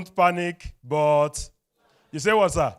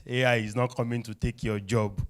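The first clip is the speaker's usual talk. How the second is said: ordinary delivery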